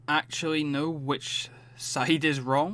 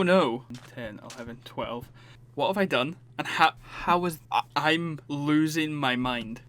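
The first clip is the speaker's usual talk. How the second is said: normal talking voice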